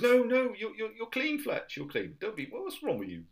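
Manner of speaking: high, mildly panicky acted protest; a touch of hammed-up fluster. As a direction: blurt it high, trying to head him off. Acted flustered Gobber stopping Fletch.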